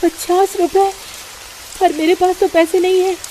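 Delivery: mother shocked at the price of bread; dismayed surprise. Acted. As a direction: realise you can't pay.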